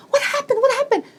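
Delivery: high, anxious repeated question; mock frantic asking; retold distress. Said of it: "in distress".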